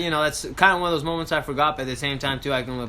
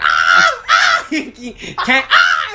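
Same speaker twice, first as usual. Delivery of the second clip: loud laughing imitation of cries; hammed-up yelps breaking off; imitating her thrashing in icy water; mock panicked screams; 6 dB louder, much slower than usual.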